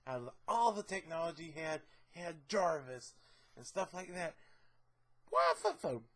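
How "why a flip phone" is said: high, disbelieving squeal of a question; pitched-up exasperated question; incredulous squeak. Acted. Baffled rant about Tony's phone.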